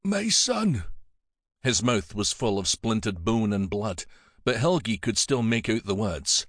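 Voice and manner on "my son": put-on high, frail old abbot voice; weak reply. Wounded abbot answering Helgi, high and weak. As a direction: barely get the words out. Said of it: "The abbot replied weakly".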